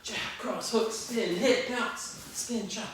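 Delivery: high, punchy combo calls; boxing coach calling combos. Shown as a demonstration. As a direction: call each strike to the class. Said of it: "instructor calling a drill combo".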